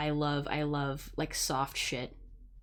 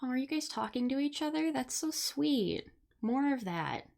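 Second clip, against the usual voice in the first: delighted cooing; 9 semitones higher than usual. Real, not acted. Streamer to viewers chatting with each other, delighted cooing. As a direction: gush in a high, tender voice.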